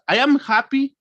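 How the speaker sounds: high, cheerful statement; acted happy mood. Shown as a demonstration. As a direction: lift the pitch happily. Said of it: "I change from happy".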